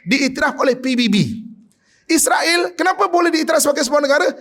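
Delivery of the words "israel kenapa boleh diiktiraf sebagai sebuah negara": high, fast shouted question; indignant shout. Real, not acted. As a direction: fire it out in outrage, fast and high. (teriakan marah, tinggi dan cepat)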